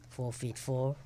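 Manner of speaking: normal talking voice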